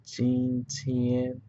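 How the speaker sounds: "jin tian" held high and level; learner's careful first tone on "jin tian". Shown as a demonstration. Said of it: "first tone".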